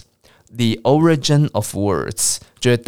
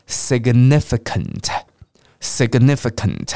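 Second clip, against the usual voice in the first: slow "significant", x0.6 pace, each syllable separate; slow model; much slower than usual. Shown as a demonstration. Teacher lets learners start slower, slow careful "significant". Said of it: "先念慢一点点".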